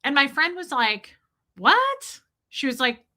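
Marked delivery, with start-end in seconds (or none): 1.6-2.2 high, rising "what?" of disbelief; incredulous. Retold or imitated. Friend reacting in disbelief to her opinion, incredulous. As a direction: blurt it out, pitch shooting up.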